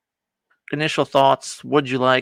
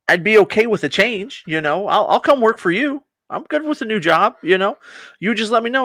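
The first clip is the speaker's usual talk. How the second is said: high, pleading pitch, eager to please; squeaky job seeker begging to be hired; eager henchman voice; 8 semitones higher than usual. Retold or imitated. Minion eagerly offers to switch sides.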